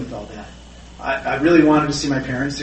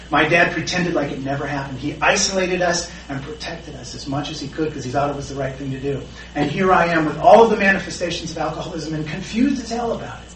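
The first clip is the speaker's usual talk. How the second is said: ordinary talk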